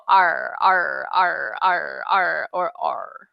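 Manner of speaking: R, R, R drilled slowly; repeated R drill. Shown as a demonstration. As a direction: practise the R sound over and over. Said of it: "go into the mirror and go".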